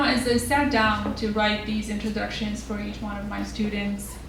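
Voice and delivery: normal talking voice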